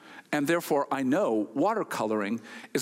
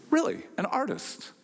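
surprised; 9 semitones higher than usual. Retold or imitated. Surprised at answer.

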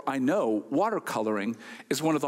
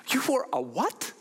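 disbelieving; 8 semitones higher than usual. Retold or imitated. Disbelief at painter.

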